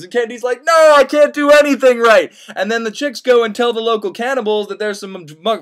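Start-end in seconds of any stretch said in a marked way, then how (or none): 0.6-2.3 loud, high whiny lament; mock despair. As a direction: wail in comic self-pity. Candide despairing.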